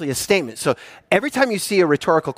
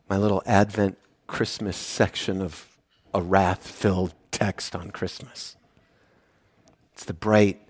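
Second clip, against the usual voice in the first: much softer, lower and slowed; hushed and tender; 9 semitones lower, 8 dB quieter, slower than usual. Real, not acted. Preacher turning from wrath to grace, softly reverent. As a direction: let the words sink to a reverent hush.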